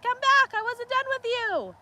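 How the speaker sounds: high, loud mock bellow for the steer; playful steer voice calling back; voicing the steer. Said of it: "He's gonna be like".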